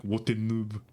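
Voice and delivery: catchphrase in a put-on French accent; French-accented pronunciation. Streamer demonstrates exchange student's pronunciation, mock French accent. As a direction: show off his French-accented way of saying it. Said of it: "the way he'd pronounce it".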